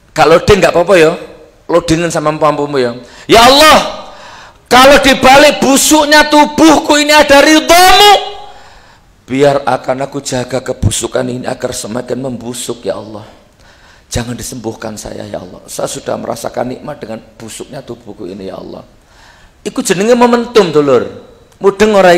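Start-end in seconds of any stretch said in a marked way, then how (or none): 3.3-8.3 much higher, loud pleading prayer; Ayub's prayer. Acted. Ayub prays aloud. 9.3-18.8 soft prayer sinking ever quieter; softer and softer devout murmur; fading prayer. Acted.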